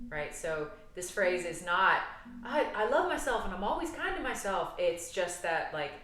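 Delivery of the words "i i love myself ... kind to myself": high, lilting, over-sweet sing-song; mock affirmation. Showing clients what the phrase is not, mock-sweet affirmation. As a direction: parody a too-cheery self-love platitude.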